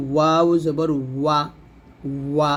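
ওয়াও জবর ওয়া with both lips rounded tight on the w; rounded-lip waw. Shown as a demonstration. Teacher showing lip position to learners, rounded waw. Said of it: "দুই ঠোঁট গোল করে".

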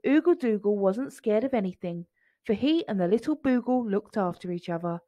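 usual speaking voice

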